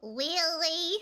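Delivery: drawn-out uwu Southern question; uwu Southern accent. Pretend. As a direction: ask it like a coy Southern cutie. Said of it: "Can you do both uwu Southern accent".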